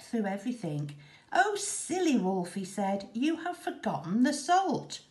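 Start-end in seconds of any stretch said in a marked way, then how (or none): 1.3-2.5 put-on high, teasing boy voice; sing-song scolding boy; mock-pitying boy. A narrator's reading. 3.1-5.0 put-on high, teasing boy voice; sing-song scolding boy; mock-pitying boy. A narrator's reading.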